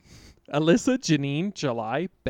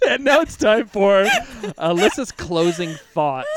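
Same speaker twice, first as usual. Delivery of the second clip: announcer voice; 4 semitones higher, 6 dB louder than usual. Acted. Show segment announcement.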